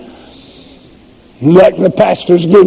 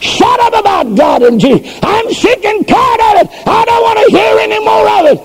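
pitching a fit; 8 semitones higher than usual. Acted. A sinner yelling at churchgoers to stop preaching, furious. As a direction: rant like a fed-up sinner sick of hearing about God. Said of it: "You pitch a fit and say".

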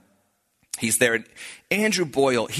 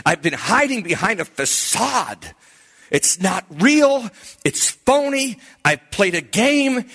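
high, slow, stressed confession; retold anguished outburst; 8 semitones higher, 4 dB louder, slower than usual. Youth leader confessing his act to his pastor, anguished outburst. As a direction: cry it out, banging home each point. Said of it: "he's banging on it like this".

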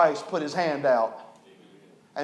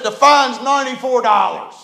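loud, higher announcement of the fine; judge's loud fine; 6 semitones higher, 8 dB louder than usual. Retold or imitated. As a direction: announce it from the bench. Magistrate states fine.

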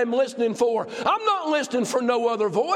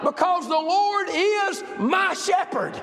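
shouted climax; 6 semitones higher, slower than usual. Acted. Preacher crowning the point before the church, shouting. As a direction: proclaim it at the top of the voice.

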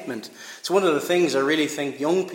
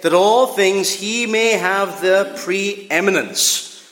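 loud, raised reading, slowing at the end; scripture proclamation; 3 semitones higher, 5 dB louder than usual. Acted. Preacher reads Colossians to the church, loud and declaiming. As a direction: declaim the passage with full force.

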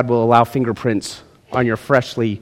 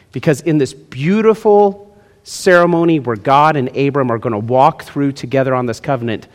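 normal talking voice

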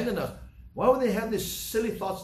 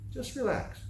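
soft, hushed reassurance; calm a frightened friend gently; hushed, soothing words to settle the worry; 8 semitones higher, 5 dB quieter than usual. Acted. Calming a worried crowd member.

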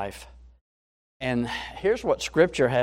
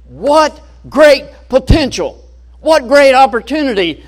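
much higher and louder, bursting with delight; excited salesman; 11 semitones higher, 12 dB louder than usual. Acted. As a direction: sell it like a thrilled salesman seeing a gold mine. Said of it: "he was all excited".

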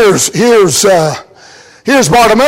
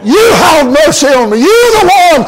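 fervent preaching; 4 semitones higher than usual. Acted. Preacher crying out the blind man's praise, full force. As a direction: proclaim it as if to the king.

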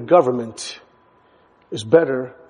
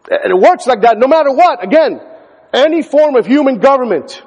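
higher, louder, faster pulpit preaching; fervent sermon voice; rising preaching; 7 semitones higher, 4 dB louder than usual. Acted. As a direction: build to a climax before the congregation. Building preaching.

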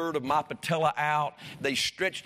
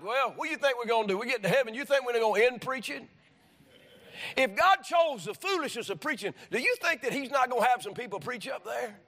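full-force preaching; 6 semitones higher than usual. Acted. Preacher urging the congregation they need the church, fervent preaching. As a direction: drive it home to the pews like a revival preacher.

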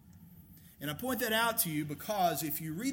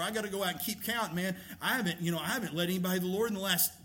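worked-up worrier, pitched higher and rushed; mock panic; 4 semitones higher than usual. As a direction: act out someone fretting that they are failing. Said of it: "You can find yourself in a place where".

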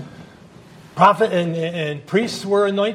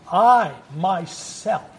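I drawn out, slow and weighted; stress on I; much slower than usual. Shown as a demonstration. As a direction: lean hard on the word I. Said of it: "it's the accent on the word I there".

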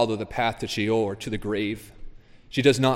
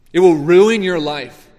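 much louder and higher, full-force; preaching shout; 5 semitones higher, 11 dB louder than usual. Acted. Preacher thundering a warning at the men in his church.

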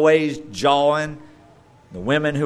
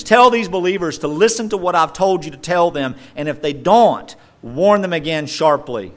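preaching at full force; 5 semitones higher, 7 dB louder than usual. Acted. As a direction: hammer home each tell these believers. Preaching Paul's charge.

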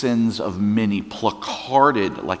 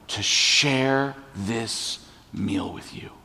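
softer, tender reproach turning to love; 3 semitones higher, 6 dB quieter than usual. Acted. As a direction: speak gently to failing friends.

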